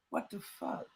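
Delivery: breathless whispered what the fuck; horrified hushed aside; whispered disbelief. Retold or imitated. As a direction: drop to a shocked whisper. Stunned reaction to the news.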